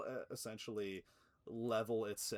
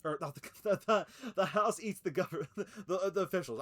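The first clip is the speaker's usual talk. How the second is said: laughing through words; 8 semitones higher, 9 dB louder than usual. Real, not acted. Laughs at own mix-up.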